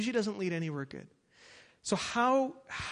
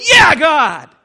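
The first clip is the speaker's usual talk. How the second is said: loud, high mock cheer of "Yeah, God!"; showy worshipper; 9 semitones higher, 22 dB louder than usual. Preacher mocking a hollow worshipper at church, loud and high. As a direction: ham up a hollow churchgoer's whoop.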